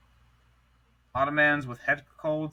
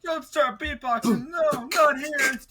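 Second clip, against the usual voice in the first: high joking voice; 12 semitones higher, 4 dB louder than usual. Pretend. Joking protest, high put-on voice.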